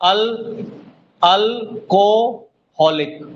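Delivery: अल्कोहलिक slowly as अल, को, हलिक; slow teacher's breakdown of अल्कोहलिक; syllable by syllable. Shown as a demonstration. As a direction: leave gaps after अल and को in अल्कोहलिक. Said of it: "तीनों तीन syllable है".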